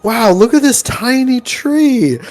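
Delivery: high, slow, wide-eyed wonder; slow high voice of a dopey villager marveling at broccoli; mock dumb amazement. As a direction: gawk at it like a simpleton. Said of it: "it's like".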